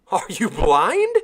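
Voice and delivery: shocked. Acted. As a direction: let the shock rise on blind. Sailor shocked after a gasp.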